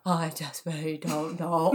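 put-on low, slow, botched English accent; mangled English accent, drawn out; failed English accent. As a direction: attempt an English accent and miss badly. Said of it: "I couldn't do an English accent".

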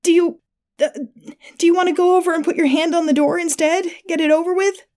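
high, halting nervous offer; panicked pleading. A narrator's reading. Attacker bargaining with wounded victim, high panicked voice.

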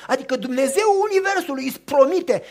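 ordinary delivery

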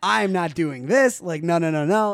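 loud, insistent retold refusal; firm refusal. Teenager refusing the prom favor, loud insistence. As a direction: dig in, stretching the words.

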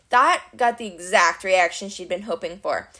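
ordinary delivery

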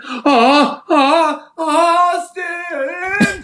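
high, hammed-up cry of a name; hammed-up moan. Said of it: "Travis screamed".

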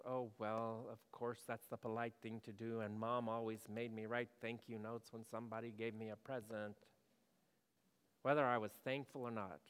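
low, soft monotone, mildly dutiful; understated, going-through-the-motions politeness. Acted. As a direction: recite it like an obligation you don't feel. Voicing dutiful, unfelt politeness.

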